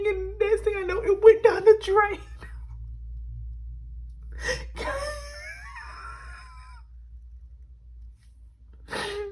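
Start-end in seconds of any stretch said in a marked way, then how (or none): 2.2-6.8 laugh-scream. Real, not acted. Fish just went down drain.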